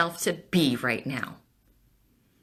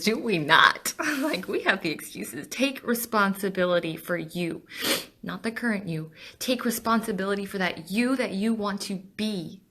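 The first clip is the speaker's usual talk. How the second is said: usual speaking voice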